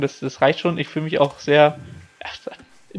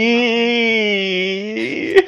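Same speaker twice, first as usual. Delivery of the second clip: disgusted ew; 6 semitones higher than usual. Real, not acted. Disgust at whisky trick.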